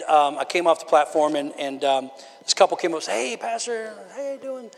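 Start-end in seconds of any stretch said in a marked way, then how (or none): 3.1-4.7 imitated greeting. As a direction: lightly voice a churchgoer greeting the pastor. Voices couple greeting the pastor.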